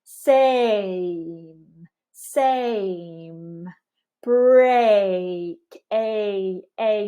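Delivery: "same", "break" and "A" drawn out with a gliding ay; exaggerated moving vowel in "A"; full diphthong glide. Shown as a demonstration. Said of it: "we want a here".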